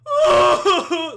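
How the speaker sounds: high, loud mock wailing; exaggerated open-mouthed sobbing howl; hysterical bawling. Shown as a demonstration. Man showing how he wasn't crying at the airport, hysterical wail. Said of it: "not like, like, hysteric".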